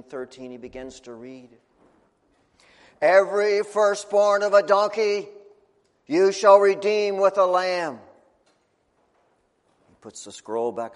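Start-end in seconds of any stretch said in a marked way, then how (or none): 3.0-8.0 loud, slow solemn reading; solemn proclamation. Acted. Priest reads the scroll to the family, loud and solemn. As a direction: pronounce the law with full weight.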